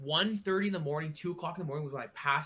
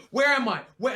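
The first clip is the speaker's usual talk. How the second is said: panicked scream; 7 semitones higher, 7 dB louder than usual. Retold or imitated. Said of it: "I'm screaming".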